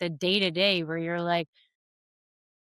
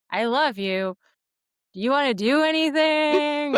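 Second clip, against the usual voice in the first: put-on sing-song to baby; 6 semitones higher, 4 dB louder than usual. Talking to her baby.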